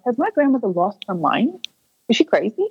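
acting out a baffled thought; retold disbelief. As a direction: wonder in disbelief, voice lifting. Retold stunned thought about grandmother.

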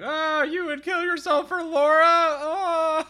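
high, loud, touched ghost voice; mock swooning ghost. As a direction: gush like a lovestruck ghost. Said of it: "And he's like".

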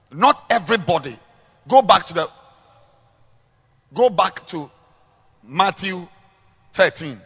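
rising preacher's call to the flock; preaching. Acted. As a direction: preach it out warmly. Preaching to the congregation.

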